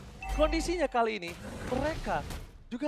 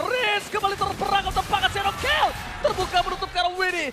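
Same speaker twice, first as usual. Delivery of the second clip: excited caster; 6 semitones higher than usual. Real, not acted. Hype caster during teamfight.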